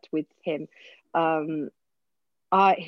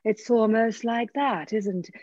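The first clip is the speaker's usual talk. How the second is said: slow, drawn-out stage Shakespearean; over-reverent classical actor, slowly stretched; reverential Shakespeare voice; 3 semitones higher, slower than usual. Acted. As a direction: intone it with mock reverence. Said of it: "There is a weird Shakespeare voice".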